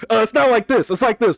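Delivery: high, sing-song mock correction; mockingly pedantic, high voice; mocking commenters. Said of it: "I hate when they do that in the comment sections".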